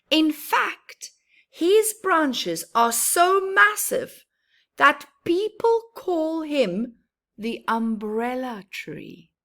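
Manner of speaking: lively storybook voice, rising on big moments; reading to children. Acted. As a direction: read it brightly to little ones.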